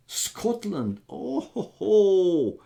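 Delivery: high, delighted exclamation of Scotland then chuckle; light up at the word Scotland; excited Scotland. Real, not acted. Streamer reacting to a viewer from Scotland, delighted.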